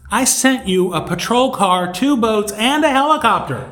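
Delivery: mock exasperation. God answering the drowned man in heaven, mock exasperated. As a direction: count them off, rising to an incredulous high finish.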